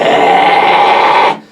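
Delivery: Hey held out as a raspy, breathy high scream; false chord high scream. Shown as a demonstration. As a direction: scream Hey with throat rasp, loose and airy. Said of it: "like, the word hey".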